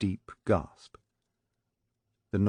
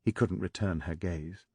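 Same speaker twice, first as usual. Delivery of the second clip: ordinary talk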